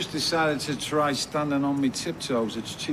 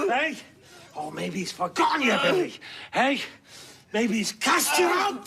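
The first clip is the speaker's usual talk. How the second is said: jeering taunts; 9 semitones higher than usual. Acted. Tormentor taunting Billy about God, shouting and sneering. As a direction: goad him cruelly till he breaks.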